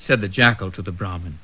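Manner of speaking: usual speaking voice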